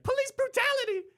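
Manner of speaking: high squealing cartoon donkey. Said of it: "then he's like".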